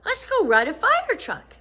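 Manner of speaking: put-on high, eager little girl voice; bright excited girl; Sheila's voice. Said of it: "Sheila said".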